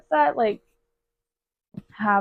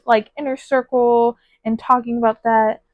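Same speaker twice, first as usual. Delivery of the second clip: usual speaking voice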